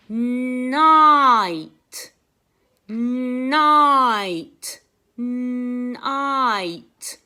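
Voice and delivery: Night, drawn out slowly and loudly on the I; stretched vowel. Shown as a demonstration. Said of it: "you can hear that I sound in the middle".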